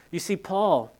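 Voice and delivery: normal talking voice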